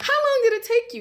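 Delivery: high, loud astonished question; shocked disbelief. Retold or imitated. Stunned niece asking her uncle how long the fix took, shocked high voice. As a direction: squeak it out in disbelief.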